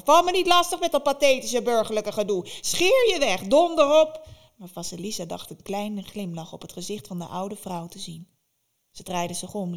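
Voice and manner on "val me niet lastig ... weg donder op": very loud, much higher shrieking crone; furious witch. Acted. Baba Yaga furiously shoos Vasilisa away, shrieking. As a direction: drive her out in fury.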